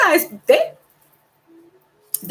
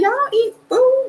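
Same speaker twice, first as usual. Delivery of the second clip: high, slow, amazed question; mock disbelief; 13 semitones higher, slower than usual. Laughing about British shows, mock disbelief. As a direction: act baffled that they eat real food. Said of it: "I'm like".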